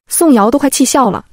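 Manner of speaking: ordinary delivery, nothing marked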